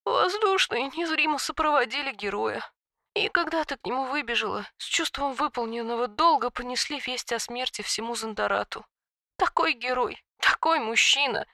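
high, soft sobbing on the inhale; talking while inhaling. A narrator's reading. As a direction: sob the words while breathing in. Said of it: "рыдая, проговорила на вдохе".

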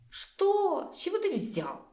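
high-pitched, surprised questioning. A narrator's reading. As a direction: react as if caught off guard. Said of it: "удивлённо отвлеклась я".

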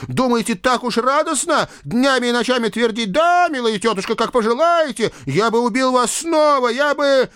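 high, loud frantic rant; carried away. A narrator's reading. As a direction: pour out the grievance hysterically. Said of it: "Но парня уже понесло".

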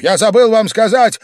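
high, loud call after someone; urgent shout. A narrator's reading. Host calling guest back from the door, shouting. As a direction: shout to halt him. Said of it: "закричал опомнившийся Вельчининов".

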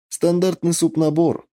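plain reading voice

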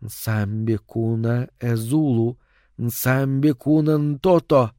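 slow murmured foreign chant; murmured incantation. A narrator's reading. Solange murmurs a charm over the misted glass, slow. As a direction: slowly murmur the spell. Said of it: "что-то пробормотала".

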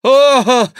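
high, loud "oh" of dismay; monk's moan. A narrator's reading. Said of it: "лепетал Гранфло". (громкий стон монаха)

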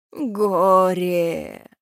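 long, drawn-out moan; distant wail. A narrator's reading. Banshee's lament carried on the wind, drawn-out wail. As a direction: stretch it out like a howl on the wind. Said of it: "донёс вой ветер".

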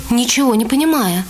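normal talking voice

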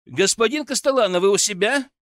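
loud, high call through a door; calling loudly. A narrator's reading. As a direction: call out to someone inside. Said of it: "громко позвал".

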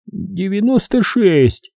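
высокий, медленный сонный счёт; тяни число высоко, как засыпающий; засыпающий считает. A narrator's reading. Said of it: "продолжал Осбертсон". (медленный сонный голос доктора)

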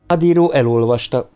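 ordinary delivery, nothing marked

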